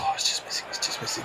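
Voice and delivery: whispering as the putt rolls; hushed, breath held; whispered tension. Real, not acted. Watching putt slide past.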